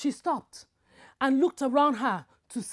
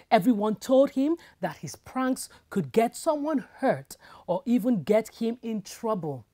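plain reading voice

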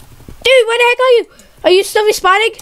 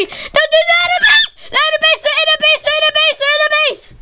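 excited shouting; 7 semitones higher than usual. Real, not acted. Gamer shouting to teammates that enemies are trapped, laughing high yell. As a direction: shout it again and again, half laughing.

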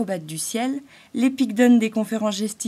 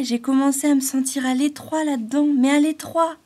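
building higher and louder; rising distress; 7 semitones higher, 6 dB louder than usual. A narrator's reading. Lisa's thought recalls being trapped, building louder. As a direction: swell with the cramped feeling. Growing cramped feeling.

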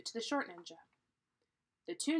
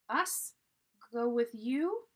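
high, loud, disbelieving rise; incredulous question; 5 semitones higher, 8 dB louder, slower than usual. A narrator's reading. Annie asks the ninja if he means them, incredulous. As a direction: ask it in loud astonishment.

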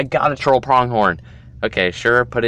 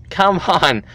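ordinary delivery

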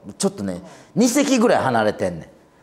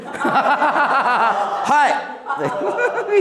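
laughing; 11 semitones higher than usual. Real, not acted.

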